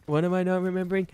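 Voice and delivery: high, loud and fast what am I not remembering; loud exasperated self voice; exasperated question. Retold or imitated. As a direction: demand it in mock frustration. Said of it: "I sound like a fucking idiot".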